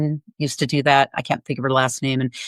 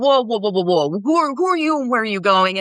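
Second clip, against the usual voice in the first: security guard challenge; 5 semitones higher than usual. Retold or imitated. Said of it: "they're like".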